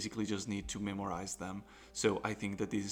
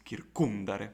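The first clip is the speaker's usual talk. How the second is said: "kircumdare" with the stress on CUM; stress on antepenult. Shown as a demonstration. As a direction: lean on the second syllable of "kircumdare". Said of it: "So it is".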